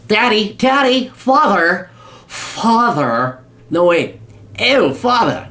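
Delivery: high, haughty upper-class British whine; mock a spoiled heir begging his rich daddy; snooty British rich kid. Spoiled son mocking Musk, begging father, in snooty British accent. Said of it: "It still sounds snooty and British".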